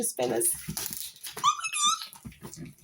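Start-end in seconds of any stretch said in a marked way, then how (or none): 1.4-2.7 very high squeaking gasp; excited squeal. Real, not acted. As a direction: squeak it out in delight. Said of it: "I'm so excited".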